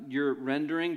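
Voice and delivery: usual speaking voice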